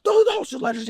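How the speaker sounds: high-pitched mock yell at the door; retold scream. Angry homeowner mock-screams at the door salesman. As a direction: imitate an angry homeowner yelling you off. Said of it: "He's just screaming at me".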